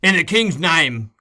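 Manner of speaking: official cry. A narrator's reading. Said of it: "cried the voices without".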